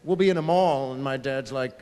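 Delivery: normal talking voice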